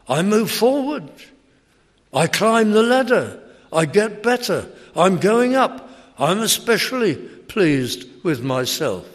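swelling self-satisfied brag; proud boast. Acted. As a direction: boast of each step up.

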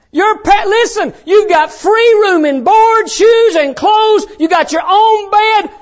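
high, loud preacher's exhortation; full-force preaching. Real, not acted. Preacher to kids on free room and board, full-force preaching. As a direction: press it home to the congregation.

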